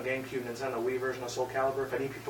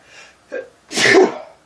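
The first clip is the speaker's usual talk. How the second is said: loud dry coughs; harsh coughing fit; 17 dB louder than usual. Real, not acted. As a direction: hack out a heavy cough. Coughing fit after dust.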